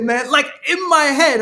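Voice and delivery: ordinary talk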